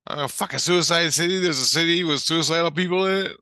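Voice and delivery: high, loud, stunned questioning; incredulous outburst. Retold or imitated. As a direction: blurt it out in disbelief. Stunned question in retold dialogue.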